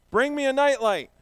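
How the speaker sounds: loud, high shout for a nightlight; demo yell. Shown as a demonstration. To the children, showing what to yell: a loud, high shout. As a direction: belt it out so the kids copy. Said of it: "you yell".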